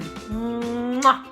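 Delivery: plain reading voice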